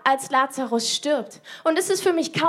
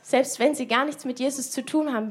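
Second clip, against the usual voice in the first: ordinary talk, nothing marked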